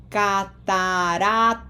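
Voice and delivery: Catarata with every A held long; long A's. Shown as a demonstration. Teacher modelling Catarata for students, slowly with long A's. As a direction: stretch each A in Catarata slowly and evenly. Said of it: "Long A's".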